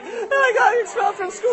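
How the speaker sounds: put-on very high, upset kid voice; crying child. Boy confesses to mom, mock crying. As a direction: wail it like a little boy. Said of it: "and he's just crying".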